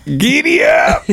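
high slow whooping yell; cowboy holler. Retold or imitated. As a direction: stretch it out like a rodeo call.

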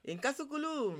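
loud, high chanted call-out; ringing call, high then falling; storyteller's call. Shown as a demonstration. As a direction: call out to the audience for their response. Storyteller's call for audience response.

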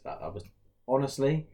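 ordinary delivery

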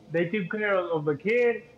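ordinary delivery

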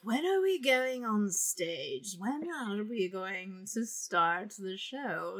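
put-on slow, slurred, whining drunk actress; a slow, woozy diva voice; drunk diva impression. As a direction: drawl it out like a tipsy diva asking for her cue. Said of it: "You did that really well".

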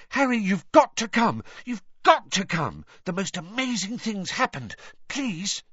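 high, breathless-style pleading; acted excited plea; panted urgency. A narrator's reading. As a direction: urge him along, excited and high. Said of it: "she panted".

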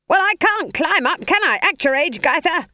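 high, loud screamed retort. A narrator's reading. Dangling witch argues back at her friend, screamed. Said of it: "stared into one another's faces and screamed".

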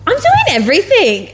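high-pitched indignant complaint; acted-out sudden realization of unfairness; mock exasperated outburst. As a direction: protest it, hammed-up and aggrieved. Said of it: "I'm like".

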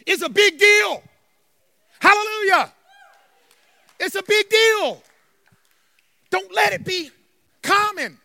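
loud, high, ringing proclamation; emphatic preaching. Real, not acted. Pastor telling church it's a big deal, emphatic preaching. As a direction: proclaim it hard, drawing out the last words.

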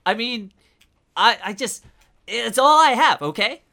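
high, agitated pleading; pleading suspect. Acted. Apartment owner pleading with intruders over his headphones, high and distressed.

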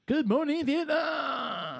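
high, long drawn-out announcer call; slow, high-pitched showman's holler; film catchphrase call. Acted. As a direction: belt out a stretched radio greeting.

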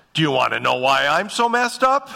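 acted inner retort. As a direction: play a self-mocking comeback, pitch rising. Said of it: "next thing that goes through my mind is".

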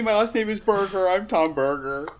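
high, hesitant character voice; timid, nervous Tom. Acted. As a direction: introduce yourself as a high-voiced nervous wreck.

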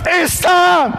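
high, loud announcement that labor has begun; urgent wife. Retold or imitated. Pregnant wife to Joseph at the inn, loud and urgent. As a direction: call it out to your husband in alarm. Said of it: "and she goes".

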